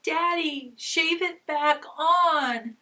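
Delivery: put-on little boy pleading, high and drawn out; three-year-old son. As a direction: imitate a small boy begging daddy. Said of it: "he said".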